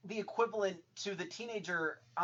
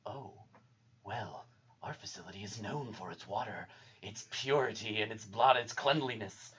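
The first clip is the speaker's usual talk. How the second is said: sinister doctor; 6 semitones lower, 6 dB quieter than usual. Retold or imitated. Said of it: "you see blood in his eyes".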